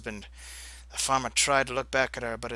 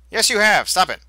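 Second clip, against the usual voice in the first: loud insistence; 7 semitones higher, 9 dB louder than usual. Real, not acted. Player argues with game.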